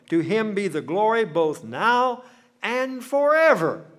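loud, high doxology at full voice, forever drawn out slowly; full-force preaching. Real, not acted. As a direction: proclaim it to the rafters, stretching forever. Doxology shouted at sermon's close.

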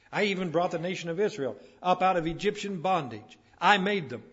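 loud, punched list from the pulpit; forceful preaching. Real, not acted. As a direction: hammer each phrase out to the congregation.

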